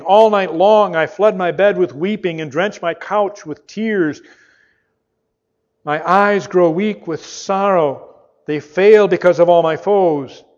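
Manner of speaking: loud, anguished reading of the psalm; psalm lament. Acted. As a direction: cry out the psalm's complaint. Psalm lament read aloud.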